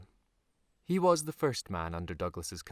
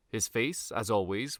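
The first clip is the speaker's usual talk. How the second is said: ordinary delivery, nothing marked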